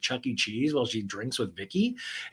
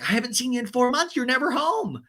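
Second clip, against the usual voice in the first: higher, quick scolding voice; high, fast Tamra; 10 semitones higher than usual. Retold or imitated. Tamra chiding Vicky, high and fast. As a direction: rattle it off high and reproachful. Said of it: "And Vicky's like".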